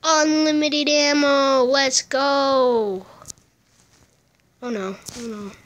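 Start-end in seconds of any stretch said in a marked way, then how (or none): none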